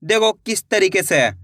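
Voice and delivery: ordinary talk, nothing marked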